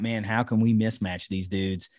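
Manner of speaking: ordinary delivery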